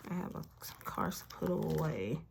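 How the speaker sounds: whispered aside; whispering. Real, not acted. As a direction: breathe it out to yourself. Whispered aside while sorting cards.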